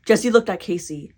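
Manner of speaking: ordinary delivery, nothing marked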